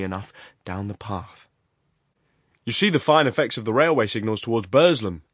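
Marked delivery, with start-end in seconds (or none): 2.6-5.2 lightly acted talkative man, louder; Horrocks. A narrator's reading. Showing his companion the railway signals, suddenly loquacious. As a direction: break into a loud, eager commentary. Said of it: "suddenly breaking into loquacity".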